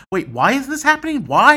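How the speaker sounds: high, loud, exasperated why; baffled outburst. Retold or imitated. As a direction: re-enact your bewilderment, voice jumping up. Acting out bafflement at what was read.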